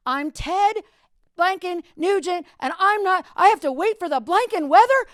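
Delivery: loud, high furious rock-star shout; angry yell. Retold or imitated. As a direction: scream it at the top of your lungs. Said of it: "yell at, at the top of his lungs".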